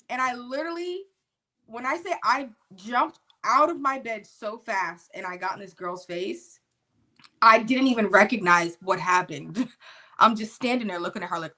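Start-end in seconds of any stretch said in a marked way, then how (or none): none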